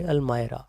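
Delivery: अलमायरा with "my" as the middle syllable; English pronunciation. Shown as a demonstration. Teacher gives the English form of almari, English pronunciation. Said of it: "English में बोलेंगे तो वो बोलेंगे".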